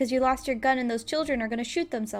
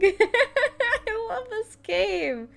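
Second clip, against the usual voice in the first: laughing; 11 semitones higher, 5 dB louder, much slower than usual. Real, not acted. Laughing through his own game.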